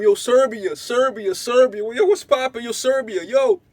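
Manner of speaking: loud, high hype call across the room; hyped-up greeting yell. Retold or imitated. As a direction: shout the name like greeting a buddy. Calling out to a friend.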